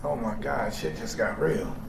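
low, soft realization; hushed shock; quiet dread. Retold or imitated. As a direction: let the fear sink in. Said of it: "from aggressive and angry to".

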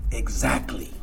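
low, soft knowing exactly; quiet agreement. Retold or imitated. Sponsor confirming his point, low and soft. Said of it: "Y él dijo".

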